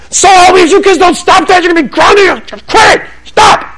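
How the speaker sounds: a flustered mother losing it in public; mock angry mom. Mother scolding kids in store.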